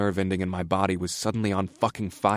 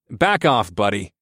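warning; 5 dB louder than usual. Acted. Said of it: "I warned him".

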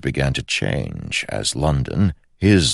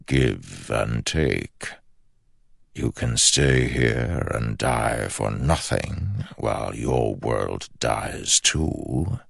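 dark whisper behind you; slower than usual. Acted. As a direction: tempt him slowly, like a shadow. The shadow speaks.

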